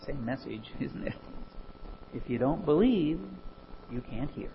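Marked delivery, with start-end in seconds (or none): none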